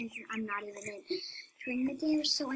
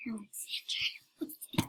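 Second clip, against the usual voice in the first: whispering; much slower than usual. Acted. Whispered name in game.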